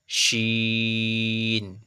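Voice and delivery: শিন with a full "sh" and long "ee"; thick "sh". Shown as a demonstration. Teacher models big sheen, শিন thick and drawn out. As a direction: curl the tongue back for a thick, held শিন. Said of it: "আওয়াজটা একটু মোটা হবে".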